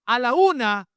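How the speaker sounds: loud, high single count; firm warning. Shown as a demonstration. Preacher shows parents counting only once, firm loud warning. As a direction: call it out firmly, once.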